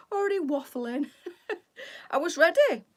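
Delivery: laughing through the words at herself; real laughter over the words. Real, not acted. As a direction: giggle it out, caught waffling.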